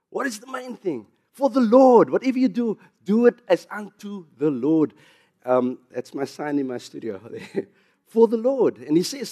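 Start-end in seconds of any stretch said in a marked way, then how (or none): 0.1-4.9 full-force preaching. Acted. Pastor preaching Romans to his congregation, at full force. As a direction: preach it out to the whole room. Preaching climax on the main thing.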